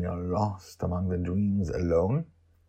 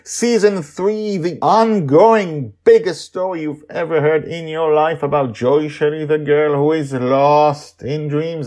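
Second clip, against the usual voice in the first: podcast host intro; 11 semitones higher, 12 dB louder than usual. Acted. Host welcoming listeners back, grand announcer voice. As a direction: boom it out like a show host.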